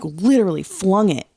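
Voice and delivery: ordinary talk, nothing marked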